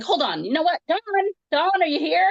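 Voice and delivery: high, sing-song call for someone absent; exasperated pretend calling; frustrated mock calling. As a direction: mockingly call out as if searching the house. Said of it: "I was getting very mad and frustrated".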